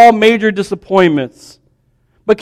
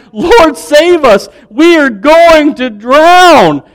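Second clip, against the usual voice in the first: slow, loud panicked cry for help, pitched higher; disciples shouting; 6 semitones higher, 4 dB louder, much slower than usual. Retold or imitated. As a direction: cry out in terror to wake Jesus.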